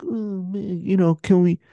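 mock-pleading, needy tone; pleading Tom impression. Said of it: "He was just like".

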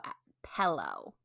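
"pillow" said low and slow in a friend's way; imitated odd pronunciation.

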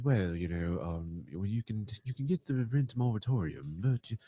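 low, soft, pretentious British voice; bad British accent. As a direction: put on a badly faked posh British accent. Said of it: "That's a really bad British accent".

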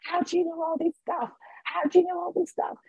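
imitating stunned clients asking in wonder; amazed girls. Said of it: "these girls started shaking in this room and going".

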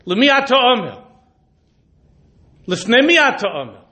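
preacher challenging his listeners; fiery preaching. Acted. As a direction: drive each question home. Rising rebuke to congregation.

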